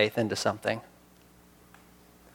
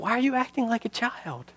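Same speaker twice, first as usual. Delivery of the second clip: much higher-pitched, incredulous reproach; appalled scolding; 10 semitones higher than usual. Acted. Onlookers reproaching an adult acting like a child, appalled scolding. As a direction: scold as if embarrassed by the tantrum.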